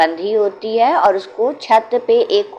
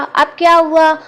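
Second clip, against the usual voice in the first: much higher, louder annoyed snap; irritated; 9 semitones higher, 7 dB louder than usual. A narrator's reading. Woken Muniya snaps at the child, loud and irritated. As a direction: woken and fed up. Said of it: "तो मुनिया ने कहा".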